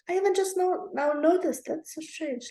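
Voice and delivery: just noticed something odd; surprised. Real, not acted.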